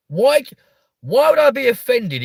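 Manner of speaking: sudden high, loud "why"; indignant outburst. Real, not acted. Streamer protesting the chat's age guess, loud high indignant. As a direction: burst out in indignation.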